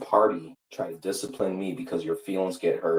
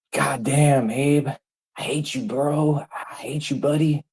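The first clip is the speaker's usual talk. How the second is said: furious shout; 8 semitones higher, 5 dB louder, slower than usual. Real, not acted. Raging at Abe directly.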